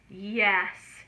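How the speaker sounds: ordinary delivery, nothing marked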